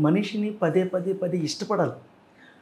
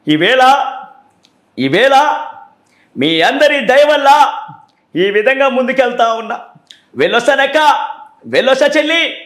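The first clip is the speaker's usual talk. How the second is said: Jagan impression; 6 semitones higher, 4 dB louder than usual. Shown as a demonstration. Mimicry artist performing for viewers and fans, Jagan impression. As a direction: declaim to a crowd in short, high-pitched bursts with pauses. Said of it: "Jagan గారితో మాట్లాడుతున్నామా అన్న feeling".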